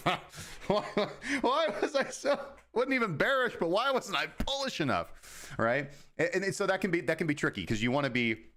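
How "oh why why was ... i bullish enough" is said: high, laughing whiny wail at himself; mock self-regret. Mocking his past trading self in a high whiny laugh. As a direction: lament his missed gains in a squeaky mock voice.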